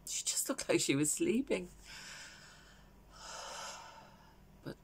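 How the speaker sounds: grief-stricken, hushed, long breathy pauses; Valerie. Acted. Bereaved mother recalling saying goodbye to her daughter, hushed grief. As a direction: let it sink softly to a near whisper. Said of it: "this is a character called Valerie".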